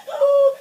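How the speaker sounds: high, loud "ooh"; mock a labour-breathing whoop; exaggerated hooting exhale; mocked breathing noise. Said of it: "I'm not doing the".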